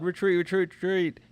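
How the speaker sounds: high, repeated alarm call; acted urgent retreat call. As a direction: call the troops back in mock panic. Acting out soldiers' call to retreat.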